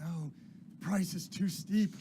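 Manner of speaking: high, loud, slow wail; agitated old William. Acted. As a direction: lament it like a man haunted. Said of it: "He says".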